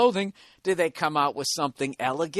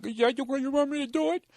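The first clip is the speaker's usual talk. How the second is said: higher put-on girly voice, coy; imitating a coy girl; 11 semitones higher than usual.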